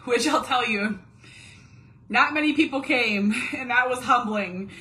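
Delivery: rueful laughing aside. Real, not acted. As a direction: laugh it off, embarrassed. Laughing about few attendees.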